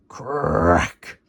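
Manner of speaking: low cracking sound effect; tree cracking. Acted. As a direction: crack like a splitting trunk. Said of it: "Went the tree".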